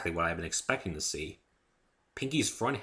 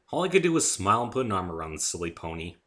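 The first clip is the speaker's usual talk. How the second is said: ordinary delivery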